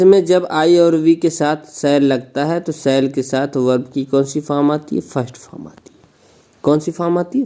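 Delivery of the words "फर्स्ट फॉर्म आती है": soft answer. Shown as a demonstration. Answering own question softly.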